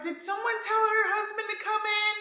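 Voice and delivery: high, mock-concerned staff voice; lightly voiced worried nurse; imitating staff. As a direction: squeak it like a flustered nurse. Quoting anesthesiologist in high voice.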